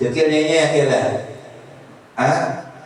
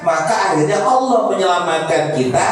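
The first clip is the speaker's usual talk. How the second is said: preaching; 6 semitones higher than usual. Acted. Preacher explaining the fruits of weeping to the congregation, preaching. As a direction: hold the pitch and draw out the endings.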